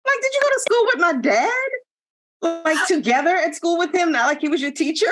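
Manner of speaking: can't believe what you heard; incredulous. Real, not acted. Mocking dated pickup line.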